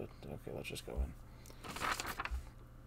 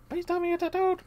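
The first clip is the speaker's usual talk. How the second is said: much higher begging take; high character voice; 6 dB louder than usual. Acted. Player repeats the plea in a high voice. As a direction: push it way up high. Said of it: "higher retake".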